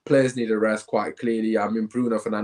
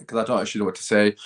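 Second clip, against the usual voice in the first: normal talking voice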